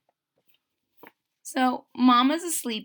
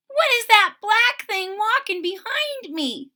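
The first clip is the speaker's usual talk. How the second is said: high, small, scared question; timid kitten; 13 semitones higher, 6 dB louder than usual. A narrator's reading. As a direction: ask it like a frightened kitten. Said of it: "she asks timidly".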